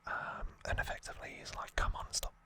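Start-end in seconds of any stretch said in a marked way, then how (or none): none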